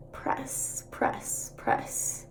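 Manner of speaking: whispered, rhythmic press; soft whisper. Shown as a demonstration. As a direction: murmur each press softly. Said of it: "you're supposed to, like, press".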